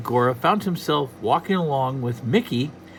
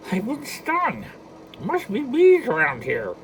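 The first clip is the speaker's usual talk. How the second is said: high squawky duck complaint; Donald Duck voice; 10 semitones higher than usual. Acted. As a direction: grumble like a cartoon duck.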